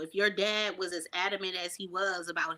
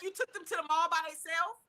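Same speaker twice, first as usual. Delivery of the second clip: shocked disbelief; 9 semitones higher, 5 dB quieter, faster than usual. Retold or imitated. Shocked parent retold.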